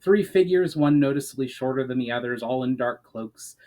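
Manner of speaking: usual speaking voice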